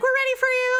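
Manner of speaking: high-pitched imitation of clinic staff; mock-cheery high call; chirpy summons. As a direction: call the patient in, voice way up. Staff summon her, retold.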